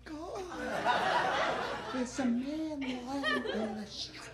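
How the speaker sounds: high, hushed, mock alarmed; soft shocked Californian. Californians seeing a man in the street, hushed mock alarm. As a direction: gasp it softly like a worried local. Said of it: "they'd be".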